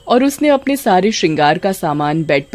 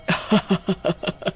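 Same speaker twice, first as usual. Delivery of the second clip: soft witch cackle; 8 dB quieter than usual. Acted. Witch laughs.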